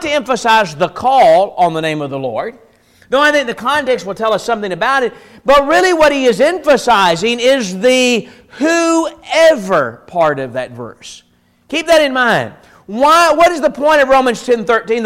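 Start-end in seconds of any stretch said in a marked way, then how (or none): 5.4-11.2 slow, weighty stress on "whoever", each word set apart. Real, not acted. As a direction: lean slowly on "whoever" as the key word. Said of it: "The point is on the whoever".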